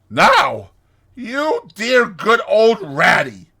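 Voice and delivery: high, loud, drawn-out coaxing plea; imploring. A narrator's reading. Toad begging Rat to come along, imploring. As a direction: wheedle him with stretched-out words. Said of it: "said Toad imploringly".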